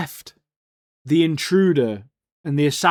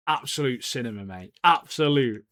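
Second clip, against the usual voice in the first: usual speaking voice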